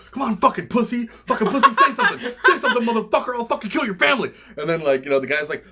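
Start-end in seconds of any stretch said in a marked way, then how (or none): 0.1-4.3 high, hammed-up angry taunting; comic raging gamer; mock trash talk. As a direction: imitate a player goading someone, loud and high. Acting out abusive player.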